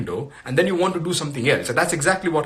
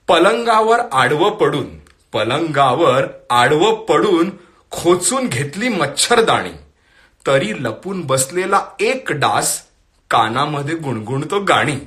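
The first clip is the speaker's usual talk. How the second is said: louder, lilting Marathi verse reading with lifted pitch; poetry recitation; 2 semitones higher, 4 dB louder, much faster than usual. Acted. Host reads a forwarded Marathi poem to viewers, poetry recitation. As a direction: recite the poem line by line to an audience.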